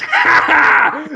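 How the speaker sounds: high mocking cackle; evil baby laugh. Baby pulling a knife, laughing evilly. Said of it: "the baby just goes".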